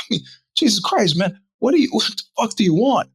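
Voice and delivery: high-pitched exasperated protest. Retold or imitated. Car buyer fed up with the dealer's paperwork demands, exasperated. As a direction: plead in disbelief, pitch jumping up.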